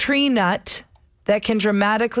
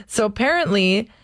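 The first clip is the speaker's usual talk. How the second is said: usual speaking voice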